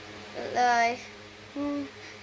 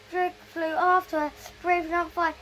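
ordinary delivery, nothing marked

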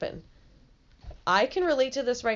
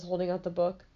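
usual speaking voice

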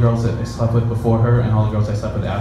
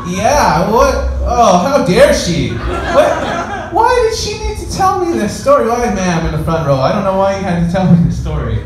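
high, squeaky mock outrage at the girl; hammed-up outraged self; 9 semitones higher, 5 dB louder than usual. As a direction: play it badly on purpose, high and incredulous. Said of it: "I purposely did it bad".

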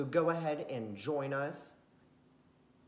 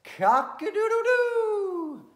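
high, loud, drawn-out rooster crow; 10 dB louder, slower than usual. Acted. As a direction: crow it out like a proud rooster at dawn. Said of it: "called out in as loud a voice as he could".

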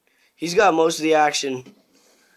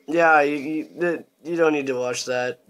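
ordinary delivery

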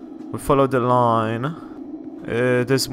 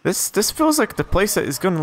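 ordinary delivery

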